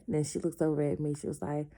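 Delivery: normal talking voice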